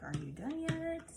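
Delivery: high whiny dog voice; impatient pup asking; voicing the dog. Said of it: "Zena's like".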